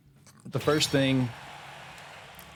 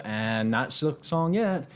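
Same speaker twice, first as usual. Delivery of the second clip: mock slow announcement; 4 semitones higher, much slower than usual. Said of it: "if they keep hitting us with".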